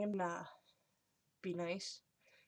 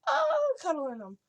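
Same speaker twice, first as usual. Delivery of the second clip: very high, loud, slow squeal; gushing; 13 semitones higher, 10 dB louder than usual. Retold or imitated. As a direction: gush over the cute pets. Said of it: "I'll always be like".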